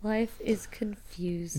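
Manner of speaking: breathy whispered echo. Real, not acted. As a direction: hush it out like a secret. Echoes partner in a whisper.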